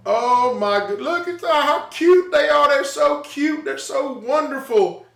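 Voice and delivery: doting parent. Retold or imitated. Doting on newborn kids.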